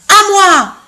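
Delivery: loud, high bellow; bellowed claim. Acted. Third elephant bellowing his claim to the fruit, loud. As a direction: roar it out greedily. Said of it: "beugla le troisième éléphant".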